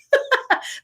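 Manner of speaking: a quick airy laugh, no voice. Real, not acted. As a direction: laugh lightly at her own joke. Joking about not getting dressed.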